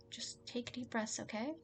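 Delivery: hushed, unhurried comfort; calming. Acted. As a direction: gently talk someone down from panic. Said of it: "I need to calm him down".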